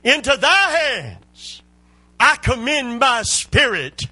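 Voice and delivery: high, loud, slow cry; proclaiming Jesus' final words loudly. Retold or imitated. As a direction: call it out with full force, high and slow. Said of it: "I believe he cried loudly".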